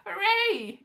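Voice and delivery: bright high cheer of delight. Real, not acted. Cheering that the actor is still alive. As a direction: cheer at the good news.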